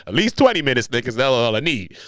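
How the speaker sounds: ordinary talk, nothing marked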